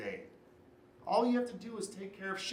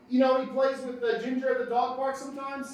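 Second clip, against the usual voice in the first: loud, higher calling voice; urgent hailing; 7 semitones higher, 7 dB louder than usual. Acted. Man asking neighbour whether she has seen his dog, urgent hailing. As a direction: call across to a neighbour urgently.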